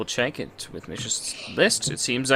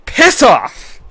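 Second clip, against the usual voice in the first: loud, high telling-off; exasperated shout; 7 semitones higher, 7 dB louder than usual. Real, not acted. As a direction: yell to chase someone away. Startled, tells someone off.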